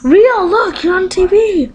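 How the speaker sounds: high, coaxing call; high-pitched pet talk. Real, not acted. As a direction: get the bird to look, voice lifted. Calling the pet bird to camera.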